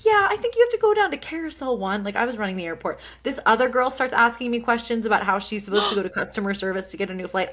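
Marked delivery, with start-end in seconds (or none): 0.0-2.0 high, chirpy cast-member voice; imitated theme-park helper, pitched way up; Disney employee mode. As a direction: direct a guest in customer-service mode. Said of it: "Disney, um, employee mode, cast member mode".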